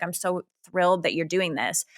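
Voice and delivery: ordinary talk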